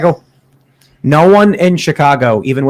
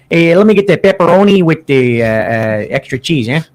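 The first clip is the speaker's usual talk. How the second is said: put-on thick Chicago accent pizza order. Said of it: "order your pizza with Chicago accent".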